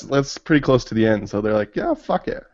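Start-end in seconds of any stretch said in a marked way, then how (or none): 1.7-2.4 high, careless toss-off; carefree. Retold or imitated. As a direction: throw it away high and loose. Retold characters giving up.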